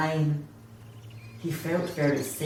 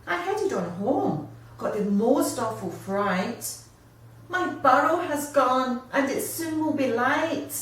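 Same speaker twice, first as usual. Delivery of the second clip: higher, distressed wombat voice; upset wombat; 6 semitones higher than usual. Acted. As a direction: pour out your troubles to a new friend. Wombat tells his troubles.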